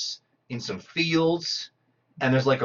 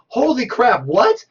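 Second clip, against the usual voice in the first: stunned surprise; 9 semitones higher, 4 dB louder, slower than usual. Retold or imitated. Viewer's stunned reaction to movie.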